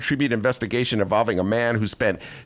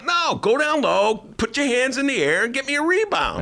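mock tall teammate; 13 semitones higher than usual. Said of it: "he's always going".

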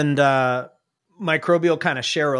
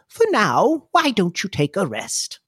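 put-on kindly older lady, starting high; Clarity; 4 semitones higher than usual. Older lady urges patient to rest, kindly lilting voice. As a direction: lilt it like a kind older lady. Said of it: "and she says".

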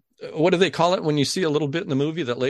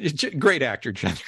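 ordinary talk, nothing marked

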